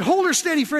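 a driver steadying his horse from the wagon, called out; firm call to a horse. Retold or imitated. Man calls to his horse.

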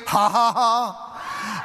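slow, deliberate ha-ha-ha; drawn-out mocking laughter; defiant laugh. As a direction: laugh right in the devil's face. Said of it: "look him in his face and say".

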